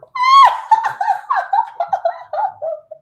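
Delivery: very high, loud squeaky burst of laughter; peals of laughter. A narrator's reading. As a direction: explode into tiny-man giggles. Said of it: "exploded into peals of laughter".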